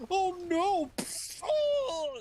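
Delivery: very high, loud comic wail; hammed-up panicked cry. Playing the one being struck, mock panic. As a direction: squeal in mock terror as the blow lands.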